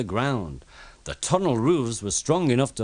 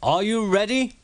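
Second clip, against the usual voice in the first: calling out; 11 semitones higher, slower than usual. A narrator's reading. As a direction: call the heave. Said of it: "called the fat controller".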